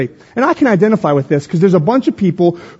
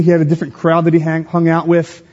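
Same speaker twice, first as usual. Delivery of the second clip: ordinary talk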